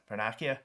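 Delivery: Vernacia said "ver-NAK-ia", as a question; questioning alternative reading of Vernacia with a hard c. Shown as a demonstration. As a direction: give Vernacia a k sound, unsure. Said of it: "I don't know".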